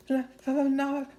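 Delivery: slow shivering stammer; teeth chattering. Acted. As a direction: stammer it through the cold. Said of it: "his teeth chattering".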